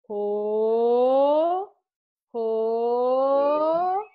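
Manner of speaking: loud drawn-out oh oh; exaggerated oh. Shown as a demonstration. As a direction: belt out big rising ohs. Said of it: "And then we're gonna pour".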